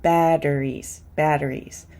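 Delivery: "batteries" said like "badderies"; fast D for T. Shown as a demonstration. Teacher models "batteries" with a fast D. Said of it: "would be pronounced as fast Ds".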